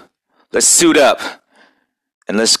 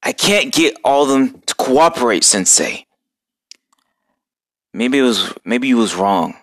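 worried confession; 4 semitones lower than usual. Acted. Worried turtle confides in master.

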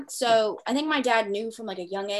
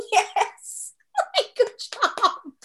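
laughing; 14 semitones higher, much slower than usual. Real, not acted. Laughing at partner's effort.

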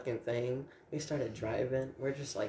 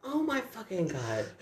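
laughing; 10 semitones higher, slower than usual. Retold or imitated. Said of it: "She's like".

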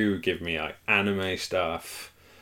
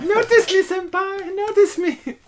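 put-on very high loud anime girl squeal; 9 dB louder than usual. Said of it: "sounding like an anime girl".

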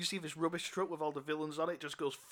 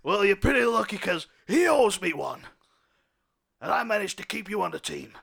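louder stern captain laying down rules; 3 semitones higher, 10 dB louder than usual. Acted. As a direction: warn the cop sternly. Captain lectures cop.

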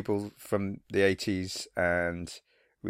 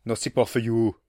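Arnie quote; 4 dB louder than usual. Retold or imitated. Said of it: "we do an Arnie quote".

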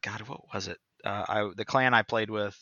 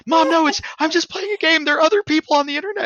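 put-on high, loud panicked child; boy defending himself to his mother; whining kid; 11 dB louder than usual. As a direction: plead like a caught kid. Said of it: "you heard him".

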